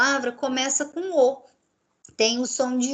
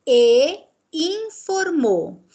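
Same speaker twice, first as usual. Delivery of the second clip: "e informou" slow, with "e" lifted and stressed; 4 semitones higher, 4 dB louder, much slower than usual. Shown as a demonstration. Teacher pointing out the Spanish conjunction, stressed. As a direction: lean on the "e" before "informou", slowly. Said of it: "olha lá".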